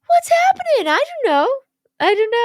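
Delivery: squeaky high panicked voice; high-pitched and flustered, not knowing; high confused voice. Retold or imitated. Acted confused quote, high voice.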